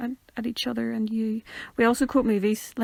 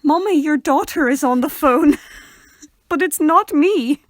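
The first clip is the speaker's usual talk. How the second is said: high, loud, slow announcement; loud teasing call through the house; loud call to Mummy; 5 semitones higher, 9 dB louder, slower than usual. Retold or imitated. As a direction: call out to mum, drawn out and playful. Interrupting Mummy, calling out.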